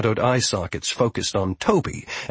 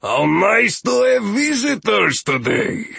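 slow, high-swinging welcome from a grumbly host; joyful rumbling growl; 10 semitones higher, 4 dB louder, slower than usual. A narrator's reading. Steward welcomes visitors to the catacombs, joyful rumble. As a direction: roll out a delighted, drawn-out greeting. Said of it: "rumbled and tumbled like the joyful growls".

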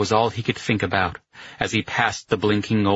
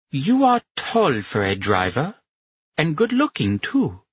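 put-on higher voice, heavy German accent; 7 semitones higher than usual. A narrator's reading. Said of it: "with a heavy German accent".

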